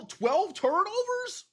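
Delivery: high, slow, incredulous question; can't believe the number he's reading; stunned disbelief. Real, not acted. Stunned reading the game stats.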